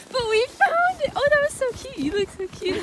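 high, loud squeal of delight; excited. Real, not acted. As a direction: squeal with joy. Finding the sledding hill.

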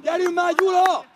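high, very loud repeated calls; sideline shouting. Real, not acted. Spectator urging Gyula to concentrate, shouting. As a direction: yell to get the players' attention. Calling players during match.

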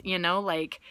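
normal talking voice

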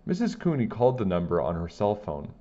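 ordinary delivery, nothing marked

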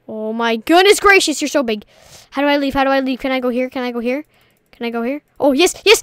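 ordinary delivery

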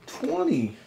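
exaggerated high. Real, not acted. Exaggerating family size.

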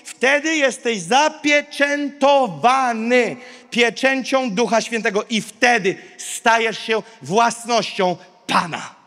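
usual speaking voice

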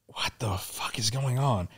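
slow, whispered bewilderment; hushed, drawn-out disbelief; dazed whisper. Retold or imitated. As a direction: whisper it slowly to yourself, confused. Dazed, watching the bowl spin.

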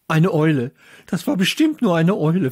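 ordinary delivery, nothing marked

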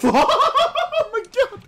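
high, loud helpless laughter; laughing too hard to speak, high and drawn out; laughing exclamation. Real, not acted. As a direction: crack up while crying out in disbelief. Streamer cracks up at teammate.

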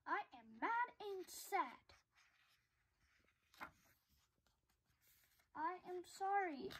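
sad and sorry. Acted. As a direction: quietly admit the sadness.

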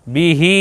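"বিহি" as one word, hee held; joined short-long. Shown as a demonstration. Teacher modelling both sounds together, short then long. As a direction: run "বিহি" together, stretching the last i. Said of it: "একসঙ্গে যদি বলি".

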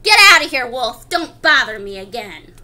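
loud, dropping, gruff send-off; rude retort. Acted. Rude third pig sending wolf away, loud rude retort. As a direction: burst out, dismissing the caller rudely. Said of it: "that rude little porker answered".

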